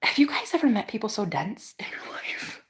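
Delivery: тихий шёпот в сторону зрителям; whispered aside. Real, not acted. Aside to viewers about dense people.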